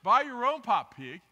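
loud, blunt mock snap at a moocher; mock rude retort. Church member to a begging preacher, mock loud snap. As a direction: bark it out like a fed-up friend. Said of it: "but you wouldn't say it".

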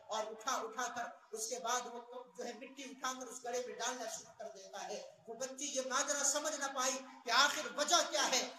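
ordinary talk

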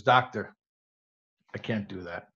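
low, hushed patient's reply; downcast refusal. Acted. Joke patient quietly refusing the doctor's advice, downcast.

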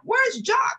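high, loud worried call; alarmed shout. Acted. Lady calls for the missing Jock, alarmed shout. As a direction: call out for a lost friend.

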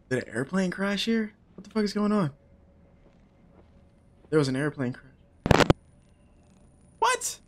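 ordinary delivery

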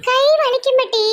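put-on high little-kid voice; objecting child. Child tells grandmother hands will hurt, protesting.

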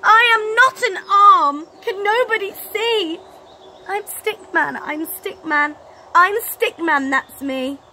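high, loud, strained cry; desperate Stickman. Acted. Stickman crying out to the boy, high and loud in despair.

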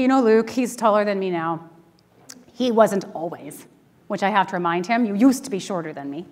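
normal talking voice

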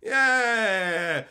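loud, high mock yell; mock shout. Mocking prudes shouting at women, loud mock yell. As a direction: bark it at them in a prudish mock. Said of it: "then it's like".

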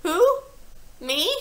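high, loud, startled; genuinely baffled; puzzled boy. A narrator's reading. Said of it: "looking genuinely puzzled".

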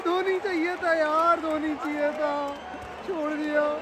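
high, slow moan, sinking gradually; fan's softening, drawn-out complaint; disappointed lament. Real, not acted. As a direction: draw it out in disappointment. Disappointed after dropped catch.

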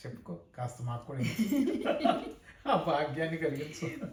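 teasing request breaking into a real laugh; laughing tease. Real, not acted. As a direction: coax through laughter. (నవ్వుతూ ఆటపట్టిస్తూ)

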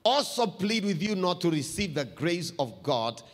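measured Bible reading; scripture reading. Acted. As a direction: read it out to the church. Reads scripture to the congregation.

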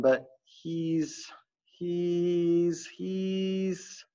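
stuck repeating he's, he's, he's; stammer, lost for words; stuttering. A narrator's reading. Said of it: "Mister P had stuttered".

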